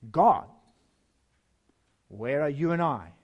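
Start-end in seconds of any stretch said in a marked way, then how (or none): 0.1-0.5 the word God punched louder; emphatic God. Acted. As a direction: land the final word hard. Climax of Genesis quote.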